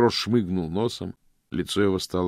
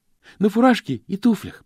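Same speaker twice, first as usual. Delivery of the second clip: put-on young postman chiming in, slightly higher and louder; eager Stanley; 11 dB louder than usual. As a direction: pick up the old man's words eagerly. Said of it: "подхватил Стэнли". (Стэнли с готовностью подхватывает)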